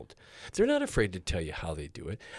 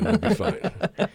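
high, loud, drawn out with laughter; laughing reassurance; 13 semitones higher, 6 dB louder, much slower than usual. Real, not acted. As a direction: laugh off their aches. Joking reassurance to sore artists.

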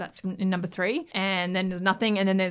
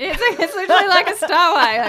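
laughing loudly through the words, higher; 11 semitones higher, 12 dB louder than usual. Real, not acted. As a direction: burst out laughing as you agree. Laughing agreement about cheesy transition.

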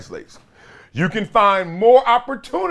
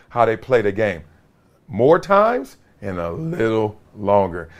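ordinary talk, nothing marked

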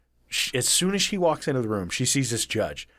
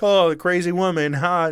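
louder, higher jeering; mock taunting; 4 semitones higher, 5 dB louder than usual. Kids jeering at a woman walking with police, mock taunting. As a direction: imitate kids laughing at someone. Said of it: "taunting her".

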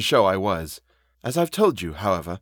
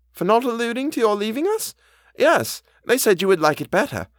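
high-pitched, earnest housekeeper voice; anxious housekeeper; 13 semitones higher than usual. A narrator's reading. Housekeeper explains her promise to the governess, high and anxious. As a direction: pitch it well up, a worried servant explaining herself.

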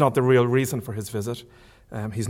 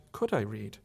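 ordinary delivery

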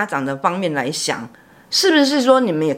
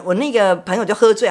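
ordinary delivery

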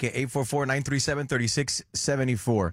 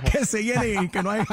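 laughing; 6 semitones higher than usual. Real, not acted. Jokes both should team up.

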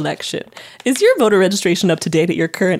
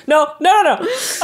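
high laughing no no no; laughing denial; 7 semitones higher, slower than usual. Real, not acted. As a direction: wave it off while laughing. Laughing retraction of innuendo.

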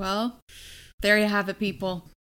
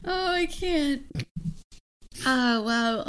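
after laughing hard; 2 semitones higher, 6 dB quieter, slower than usual. Real, not acted. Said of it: "I'm crying. I'm in tears".

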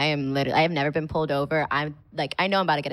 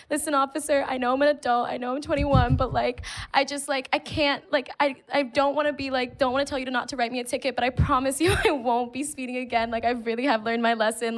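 higher, hurried plea to the officer; pleading with officer; 6 semitones higher than usual. Retold or imitated. Begging the officer not to write a ticket, higher pleading. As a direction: beg your way out of a ticket, pitched up.

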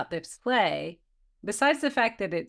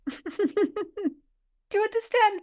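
slow, high, laughing taunt; laughing scornfully; 12 semitones higher, much slower than usual. Acted. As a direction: laugh at him as you ask.

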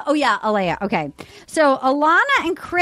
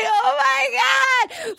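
mock gasp; 8 semitones higher than usual. Women on the show fake-fussing over Jasenia, mock dismay.

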